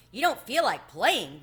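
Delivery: the mouse can't believe his ears, loud; exclaimed in disbelief. A narrator's reading. Tucker Mouse exclaiming at Chester's refusal, loud disbelief. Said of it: "the mouse exclaimed".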